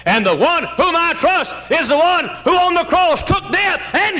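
very high, slow pulpit shout; impassioned preaching. Real, not acted. Preacher proclaims death defeated on the cross, triumphant high shout. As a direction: hammer each phrase out at full cry.

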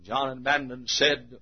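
very low, soft narration; hushed preaching. Real, not acted. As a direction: drop right down before the reply. Drops to a hush between cries.

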